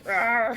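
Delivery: short playful roar; hammed-up growl; mock monster roar. Imitating rubber shark roar.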